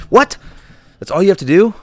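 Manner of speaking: high, loud disbelief; can't believe it's that easy; incredulous. Acted. Player disbelieving the Shaman's easy summoning, high and loud.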